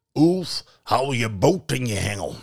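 loud troll order. Acted. Troll ordering Ulf, loud. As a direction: bark at him like a troll.

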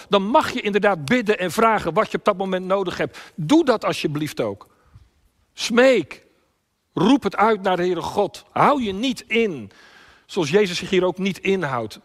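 high, loud exhortation; impassioned preaching. Real, not acted. Preacher urges congregation to beg God, impassioned. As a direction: urge them at full force.

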